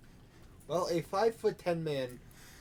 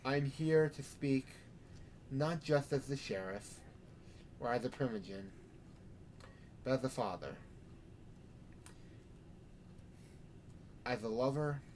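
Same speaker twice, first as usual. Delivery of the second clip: sermon; 5 dB quieter than usual. Acted. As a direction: preach it with measured pauses. Sheriff preaching to vampires.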